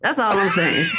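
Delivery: laughing, half-shouted tag line; laughing punchline. Retold or imitated. Mom finishing her roast of the toddler, laughing. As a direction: land the punchline through laughter.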